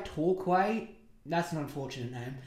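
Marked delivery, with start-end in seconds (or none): 0.0-0.9 "Torquay" said as TOR-kway, with an aw vowel; alternate pronunciation. Shown as a demonstration. Tries alternate pronunciations of town name.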